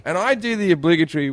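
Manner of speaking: ordinary delivery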